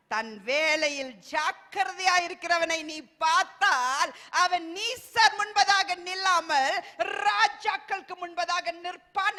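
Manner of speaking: slow, loud, solemn proclamation; full-force preacher reading a verse slowly; preaching scripture. Acted. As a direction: declare the verse from the pulpit, drawn out.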